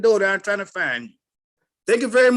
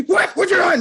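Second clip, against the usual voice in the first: sharp, higher, louder challenge; ready to fight; 8 semitones higher, 5 dB louder than usual. Retold or imitated. Retold man confronts the speaker, sharp and louder. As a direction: square up with a quick challenge. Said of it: "They ready to fight".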